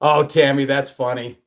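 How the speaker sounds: ordinary talk, nothing marked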